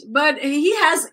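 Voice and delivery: ordinary talk, nothing marked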